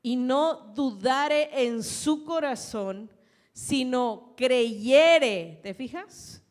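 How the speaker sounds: loud, slow preaching of the verse; preaching scripture. Acted. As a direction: proclaim each word to the congregation. Preacher reading scripture aloud.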